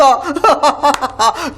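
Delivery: scoffing burst of laughter; disbelieving ha-ha-ha; mocking laugh. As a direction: laugh at a ridiculous boast. Mocks the claim to be Yamraj.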